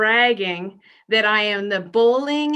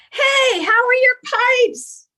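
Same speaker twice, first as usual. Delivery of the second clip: loud, much higher yell across the yard, slower; neighbor yelling; 14 semitones higher, 6 dB louder, slower than usual. Retold or imitated. Neighbor next door yells to her after the storm, loud high call.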